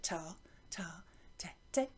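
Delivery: whispering the rhythm under the class; barely voiced chant beside the children; whispered along. Shown as a demonstration. Teacher whispering along while pupils chant. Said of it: "You do it".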